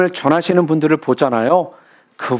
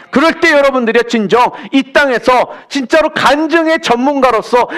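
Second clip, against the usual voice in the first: raised, fervent preaching voice; 7 semitones higher than usual. Acted. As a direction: pour out the blessing with rising force. Preacher building to blessing. (높고 힘찬 설교조)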